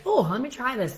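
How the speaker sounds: high, airy mock shopper voice; mimicked carefree shopper. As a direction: lightly imitate an eager woman trying a tester. Mimics women at tester counter.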